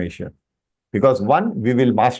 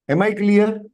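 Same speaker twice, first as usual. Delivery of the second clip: usual speaking voice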